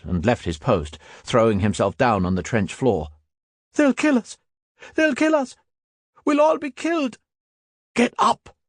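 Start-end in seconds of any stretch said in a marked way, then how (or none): 3.7-7.2 very high terrified screams; panicked shouting. Acted. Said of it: "He began to cry and shout". 7.9-8.6 shouted order. Acted. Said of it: "shouted the Stubbs".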